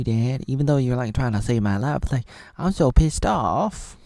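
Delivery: loud scolding rant at dad; angry rant. Pretend. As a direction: complain loudly, rushing in places. Daughter scolds her dad.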